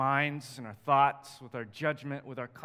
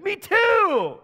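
squeaky, much higher eager cry; delighted; 10 dB louder than usual. Retold or imitated. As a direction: chirp it out, thrilled to agree. Delighted at shared faith.

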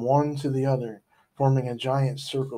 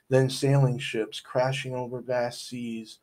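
ordinary delivery

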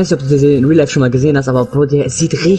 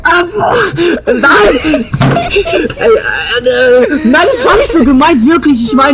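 panicked denial; 12 semitones higher, much slower than usual. Real, not acted. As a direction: stammer out the apology. Panicked backtracking.

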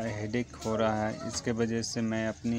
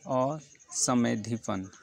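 असमेधिपन drawn out very slowly, syllable by syllable; slow repeat; much slower than usual. Shown as a demonstration. Teacher repeating a word so students can copy it, very slowly. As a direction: say असमेधिपन again slowly for dictation. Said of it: "मैं उस शब्द का भी उच्चारण कर दे रहा हूँ".

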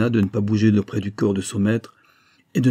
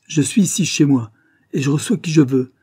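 higher, louder, indignant; grating retort; 5 semitones higher, 5 dB louder than usual. A narrator's reading. Former lawyer standing up to police at home, grating. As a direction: assert your rights with an angry edge. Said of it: "grinça l'ancien avocat".

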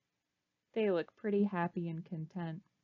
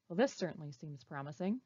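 normal talking voice

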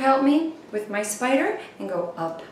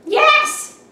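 much higher, louder squeal of yes; excited baby bug; 8 dB louder than usual. Acted. Baby bug accepts the spider's proposal, squealing high. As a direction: squeak out a thrilled little yes. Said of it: "And the bug said".

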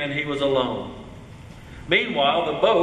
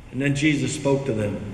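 usual speaking voice

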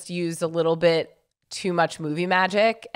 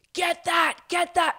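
imitated urging owners; 11 semitones higher than usual. Dog owners pointing out the bar to jump at, imitated urging.

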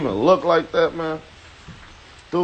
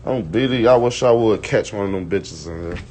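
ordinary talk, nothing marked

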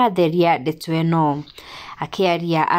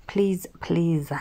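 begging whisper; 5 dB quieter than usual. Retold or imitated. Begging friend pleads in retold chat.

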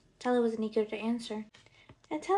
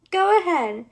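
loud, high shout of defiance; defiant cry; 7 semitones higher, 11 dB louder than usual. A narrator's reading. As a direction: shout it back, much louder and higher. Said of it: "Tella cried as she barreled".

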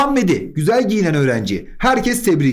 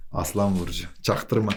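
low, much softer aside; hushed aside; 11 semitones lower, 8 dB quieter than usual. Real, not acted. Teacher slipping students a star-sign joke, hushed aside. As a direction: drop low and quiet, keeping it covert.

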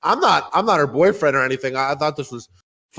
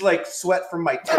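usual speaking voice

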